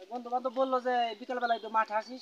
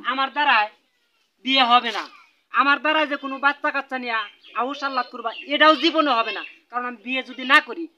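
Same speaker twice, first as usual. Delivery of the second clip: loud, forceful refusal to the parents; emphatic refusal; 11 dB louder than usual. Acted. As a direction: put your foot down, voice raised. Son firmly refuses parents' marriage plea. (জোর গলায় সাফ অস্বীকার)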